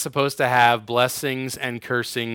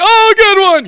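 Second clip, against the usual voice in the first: very high, loud mock squeal; hammed-up retort; 11 dB louder than usual. Sarcasm lover taking a jab in fun, hammed-up retort. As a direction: whoop it out in an exaggerated high voice.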